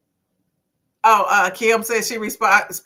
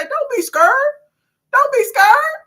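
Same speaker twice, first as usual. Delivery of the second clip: high-pitched teasing squeal; mock-reassuring, sung-out high pitch; playful coaxing; 5 dB louder than usual. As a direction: coax them in a silly, very high voice. Teasing shy male viewers.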